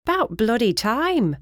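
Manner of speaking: put-on sharp British accent, high and drawn out; slow, amused complaint in a British accent. A narrator's reading. Housekeeper finally let in from the storm, sharp British accent. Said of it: "commented with a sharp accent and a bit of a laugh".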